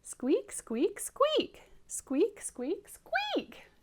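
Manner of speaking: put-on high squeaky demo for children; high-pitched hatchling imitation; squeaking. Shown as a demonstration. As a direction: squeak along, leaping up high on the last. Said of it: "Can you do that like I did?".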